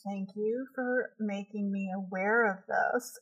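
soft, slow, dry sarcasm; mock gratitude through clenched patience; sarcastic thanks. Wife answering husband's complaint, dry sarcastic thanks. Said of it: "And I'm like".

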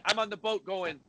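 usual speaking voice

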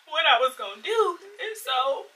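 choked-up crying through the words. Real, not acted. Crying while telling friends about her panic attack. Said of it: "I'm getting emotional".

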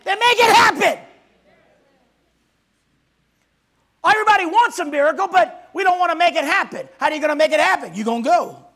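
loud, high, rousing preacher's cry; full-force preaching. Acted. Pastor rousing the congregation, loud full-force preaching. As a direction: whip the congregation up to believe.

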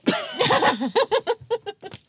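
big high laugh, loud. Real, not acted. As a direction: burst out laughing loudly.

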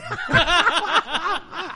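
high, drawn-out laugh; rolling laughter; long laugh. Real, not acted. Laughing at the gesture.

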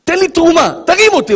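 God asking for your hardest struggle. Acted. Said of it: "אומר הקדוש ברוך הוא".

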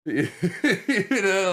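laughing through the words; a real burst of laughter, pitched high and loud. Real, not acted. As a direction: laugh it out, then carry on. Laughs about feeling normal.

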